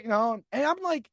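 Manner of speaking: ordinary talk, nothing marked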